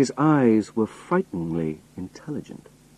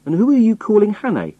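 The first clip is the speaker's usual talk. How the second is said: high, loud indignant challenge; rough retort; 12 semitones higher, 5 dB louder, faster than usual. A narrator's reading. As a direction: throw the question back roughly, voice raised. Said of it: "I said roughly".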